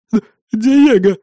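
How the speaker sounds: high, slow frightened stammer; trembling lips. A narrator's reading. Captive gives his name to interrogator, trembling. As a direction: stammer it out in fear. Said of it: "трясущимися губами ответил тот".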